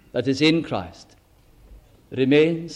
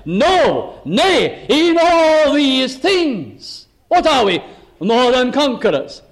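rising, ringing preacher's cry; full-force preaching; 8 semitones higher, 4 dB louder, slower than usual. Real, not acted. Preacher proclaiming victory to the congregation, ringing out. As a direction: build it up and proclaim the triumph.

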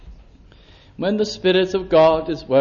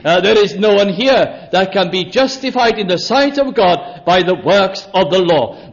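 usual speaking voice

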